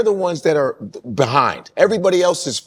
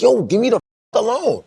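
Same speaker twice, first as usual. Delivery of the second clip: higher-pitched, fed-up outburst; mock-irritated, pitched-up plea; acted exasperation; 7 semitones higher than usual. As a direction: act out shaking off someone tugging at you. Voices pushing off someone tugging.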